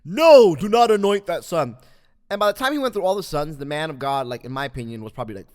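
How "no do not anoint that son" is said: very loud, booming God; God's movie voice. Acted. Host plays God forbidding the anointing, loud and booming. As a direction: thunder it out as the voice of God. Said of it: "use God's voice with a real deep voice".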